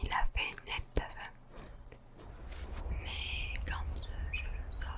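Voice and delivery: normal talking voice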